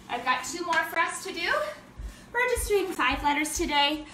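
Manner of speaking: normal talking voice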